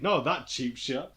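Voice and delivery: ordinary delivery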